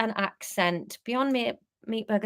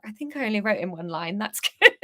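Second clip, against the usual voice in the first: ordinary delivery, nothing marked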